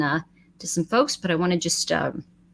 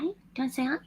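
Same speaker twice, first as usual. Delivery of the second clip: raised sing-song pet voice; pet talk; 5 semitones higher, 11 dB quieter, much faster than usual. Real, not acted. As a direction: coax the dog, high and sweet. Same question to dog asked twice.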